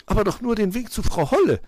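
high, loud magpie voice; defensive magpie. A narrator's reading.

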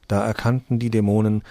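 ordinary delivery, nothing marked

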